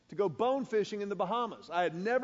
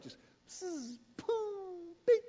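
quick zip, then a high boom and beep; playful sound effects for a flawless cast; imitated casting noises; 11 semitones higher, much slower than usual. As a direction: mimic a fly line landing right on target. Perfect cast into the backyard.

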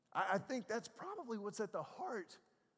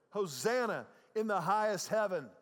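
loud proclamation of praise; ringing, louder acclamation; crowd shouting; 7 dB louder than usual. Acted. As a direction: call it out like a cheering crowd. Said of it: "those that followed shouted".

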